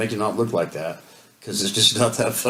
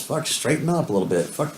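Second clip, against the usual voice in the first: ordinary delivery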